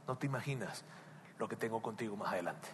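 put-on calm a frightened child with a promise; God hushing and reassuring softly; tender voice of God. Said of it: "y Dios te diría".

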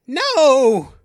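loud, high cry of dread; terrified cry. Acted. Stranger learns he is now a hostage, crying out in fear. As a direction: cry out in protest, high and long.

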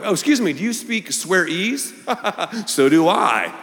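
high, mock-polite prissy voice; mocking a chameleon who talks differently per group; prim mock politeness. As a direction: put on a fussy polite tone. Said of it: "you're talking this way".

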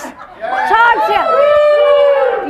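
high, slow, stretched-out crowd scream; drawn-out excited yell; screamed show name. Shown as a demonstration. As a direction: belt out the show title like a cheering fan. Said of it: "You scream for Talk Tales like this".

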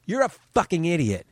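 put-on a young girl insulting the reporter, high and blunt; girl's retort. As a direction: snap it like a cheeky kid. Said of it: "And she goes".

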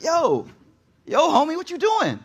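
high-pitched, incredulous challenge; indignant mock protest. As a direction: call out a stranger in playful disbelief. Driver protesting grabbed steering wheel.

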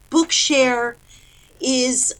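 ordinary talk, nothing marked

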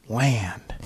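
hushed, reverent whisper of one word. Retold or imitated. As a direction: breathe it like sacred advice. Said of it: "It's like my father always said".

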